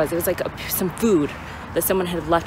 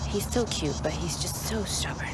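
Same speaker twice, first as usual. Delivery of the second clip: fond exasperated whisper; 7 dB quieter than usual. Real, not acted. Exasperated at stubborn dog.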